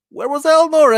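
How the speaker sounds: high-pitched disbelieving "Where was Elnor"; incredulous question. Real, not acted. Host baffled by a plot gap, incredulous. Repeats question, much higher.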